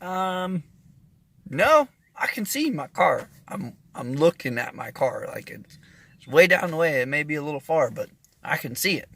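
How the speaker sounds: high, loud, insisting he sees it; insistent disbelief. Retold or imitated. Telling the friend his car is fine, high and insistent. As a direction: brush off the news, certain.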